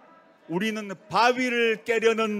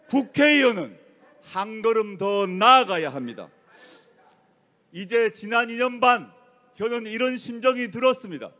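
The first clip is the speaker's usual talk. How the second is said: normal talking voice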